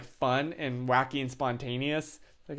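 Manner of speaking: ordinary delivery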